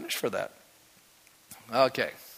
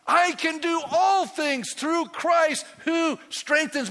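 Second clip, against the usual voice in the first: 1 preached shout; 12 semitones higher, 5 dB louder, slower than usual. Real, not acted. Preacher proclaiming verse.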